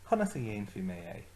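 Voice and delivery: Conas a dhéanfaí mé é run together fast; quick joined phrase. Shown as a demonstration. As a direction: say Conas a dhéanfaí mé é at full speed in one go. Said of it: "Agus arís".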